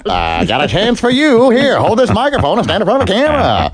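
hammed-up gangster recruiter; 1920s gangster. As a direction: pitch it like a shady talent scout. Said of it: "No nineteen twenties gangsters".

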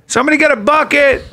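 mock bystander call. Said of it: "And you heard this".

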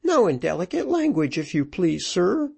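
high-pitched, indignant widow; high scolding interruption; interrupting widow. A narrator's reading. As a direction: cut in with a high, prim rebuke. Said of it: "interrupted the widow".

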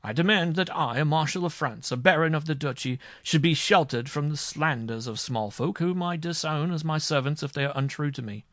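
high, loud, haughty outrage; indignant demand. A narrator's reading. Marshal demands shelter from servants' slanders, indignant.

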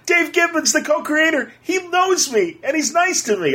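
giddy excitement. Retold or imitated. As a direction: gush like a kid who met his hero. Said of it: "I'm like".

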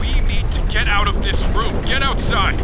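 high, loud desperate yelling; desperate shout. Acted. Time traveller shouts for the girl to get out. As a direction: scream for them to get out. Escaping collapse.

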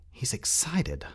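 high, whispery inner voice; hushed thought. A narrator's reading. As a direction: think it aloud in an amazed whisper.

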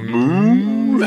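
imitated cow call; cow moo. Shown as a demonstration. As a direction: let out a lazy, drawn-out moo. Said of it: "a cow moo in the middle of".

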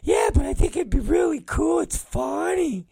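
high, eager falsetto impression; mock him pitching a dumb idea in a squeaky voice; Brandon. Coworker pitching a wrestler to his boss, high mocking falsetto. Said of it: "Brandon has a lot of stupid".

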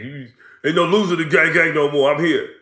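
high, loud hype man; hyped wrestler voice. Pretend. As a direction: shout it like a proud gang leader. Voicing wrestler joining gang.

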